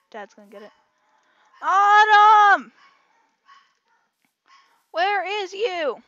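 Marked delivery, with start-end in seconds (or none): 1.6-6.0 very loud, high holler; drawn-out shout, pitched up; over-excited calling. Real, not acted. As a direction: yell slowly for the lost dog. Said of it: "I got too excited".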